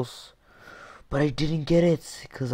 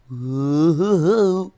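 high, loud rising whoop; excited whoop; 9 semitones higher, 7 dB louder than usual. Real, not acted. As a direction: whoop up into a squeal. Celebrating a success in game.

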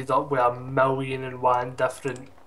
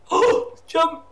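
alarmed shout; 6 dB louder than usual. Real, not acted. Sudden alarm.

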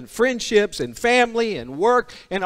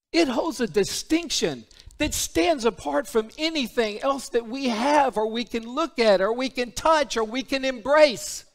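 usual speaking voice

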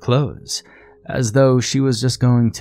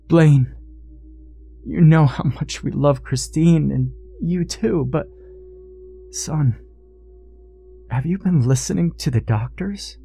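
higher-pitched, halting appeal; earnest pleading; 5 semitones higher than usual. A narrator's reading. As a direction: reason with him imploringly, pausing between phrases. Father pleading with son-in-law.

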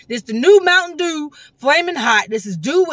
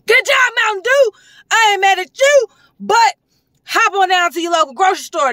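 hyped praise; 7 semitones higher than usual. Real, not acted. Reviewer praises Mountain Dew, high excited cheer. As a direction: cheer the brand on like a fan.

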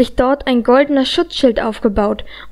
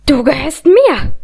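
possessive outburst; 3 semitones higher, 3 dB louder than usual. A narrator's reading. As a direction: pitch leaping on the middle word. Villainess claims her captive. (besitzergreifend, aufgebracht)

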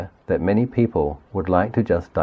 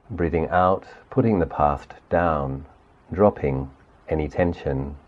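guided meditation. Acted. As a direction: gently lead the listener through the body, unhurried. Leads guided body sweep meditation.

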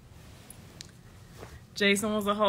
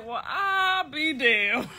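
higher, drawn-out disbelief breaking into laughter; retold astonished reaction; 5 semitones higher, much slower than usual. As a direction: stretch it out in mock amazement, then crack up.

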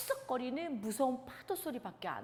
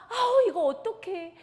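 high, loud, panicky wail; frightened disciples; 5 semitones higher, 6 dB louder than usual. Acted. Disciples in the storming boat crying out in terror. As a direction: cry out in fear of drowning. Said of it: "하고 모두 겁내하고 있었어요".

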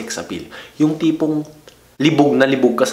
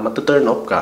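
ordinary talk, nothing marked

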